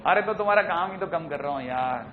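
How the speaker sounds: ordinary talk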